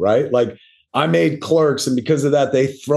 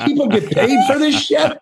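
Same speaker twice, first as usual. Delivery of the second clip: amazed disbelief; 13 semitones higher than usual. Retold or imitated. Shocked at being paid.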